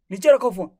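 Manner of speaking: loud, sudden burst of irritation; irritated order. Acted. Husband ordering wife to put the phone down, irritated order. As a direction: raise your voice sharply to command.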